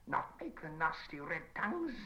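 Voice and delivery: high, slow hissing creature voice; hissed. Acted. Gollum hisses warnings about fire. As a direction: hiss it out like a frightened creature. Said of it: "hissed Gollum".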